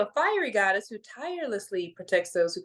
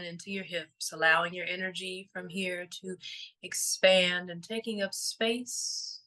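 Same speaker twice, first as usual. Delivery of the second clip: guided meditation; 3 semitones lower than usual. Real, not acted. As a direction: gently guide them into stillness, letting each phrase settle.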